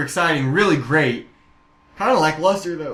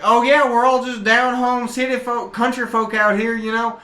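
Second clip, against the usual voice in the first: high-pitched down-home country local; put-on country-folk voice, pitched up; folksy townsfolk; 8 semitones higher than usual. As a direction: play a gushing small-town local. Acting Texas townsfolk in a bit.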